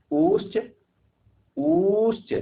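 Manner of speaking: उश्च sung up high and rising; drawn-out chanting उश्च, climbing in pitch. Shown as a demonstration. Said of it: "ध्यान से देखिएगा".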